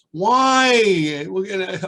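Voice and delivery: put-on high eager kid voice; child's question. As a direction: pipe up like a small child. Kids voiced asking teacher.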